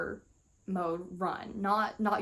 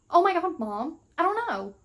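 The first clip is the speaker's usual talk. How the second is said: exasperated daughter; 6 semitones higher than usual. Acted. Daughter insisting to Mom she has no idea, acted exasperation. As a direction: push back at Mom, pitched up.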